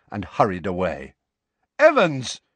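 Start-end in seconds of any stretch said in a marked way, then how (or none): 1.8-2.4 high, loud call; shouted after. Acted. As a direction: call after her as she leaves. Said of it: "James shouted after her".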